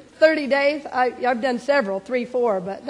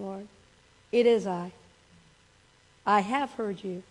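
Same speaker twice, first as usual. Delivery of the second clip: softer prayer voice, chant the thanks; leading prayer; 3 semitones lower, 4 dB quieter than usual. Acted. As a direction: guide the room gently into prayer. Leads a closing prayer.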